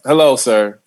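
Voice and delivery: politely measured code-switched greeting; manners voice. Shown as a demonstration. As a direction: put on your good-manners voice. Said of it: "with my manners voice".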